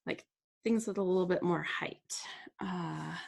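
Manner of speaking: whispered to herself while working. Real, not acted. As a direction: think aloud under her breath. Muttering while crafting on camera.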